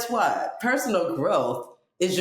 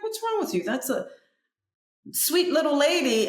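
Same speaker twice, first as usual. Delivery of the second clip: pitched way up, protesting; high indignant quote; 11 semitones higher than usual. Retold or imitated. Telling friend off about a stranger, high indignant voice. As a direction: squeal the protest in disbelief. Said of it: "I was like".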